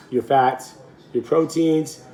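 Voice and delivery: ordinary delivery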